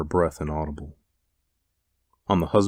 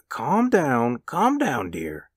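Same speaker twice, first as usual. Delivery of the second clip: hasty calming; 7 semitones higher, much slower than usual. A narrator's reading. Husband to his frightened wife, hastily calming. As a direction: hush her in a higher voice. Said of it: "he said hastily".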